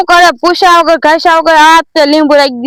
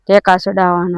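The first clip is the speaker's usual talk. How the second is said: mocking echo; 13 semitones lower, 8 dB quieter than usual. Echoes the other's words back.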